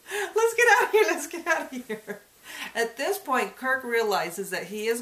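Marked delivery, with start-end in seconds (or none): 0.1-2.2 high, loud, frantic cry to flee; panicked scream. Retold or imitated. Date in the back seat screaming in panic to escape, high and loud. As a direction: shriek it like you're terrified of being caught. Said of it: "And she's screaming".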